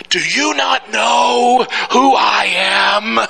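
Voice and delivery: slow, high, loud shout of outrage; furious shout. Retold or imitated. Angry passenger shouting at the ticket agent, enraged. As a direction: bellow it like an entitled man losing it. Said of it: "full of rage and anger".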